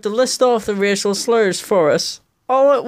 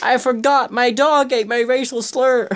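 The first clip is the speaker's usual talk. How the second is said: schoolkid excuse; 6 semitones higher than usual. Pretend. Mock schoolkid excuse to teacher.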